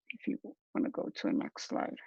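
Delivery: quick whisper to a teammate off to the side; hushed quick aside, not for the audience. Real, not acted. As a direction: drop to a whisper to cue the next slide.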